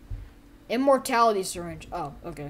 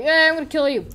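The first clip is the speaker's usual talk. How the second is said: mock threat; 7 semitones higher, 11 dB louder, faster than usual. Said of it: "They're like".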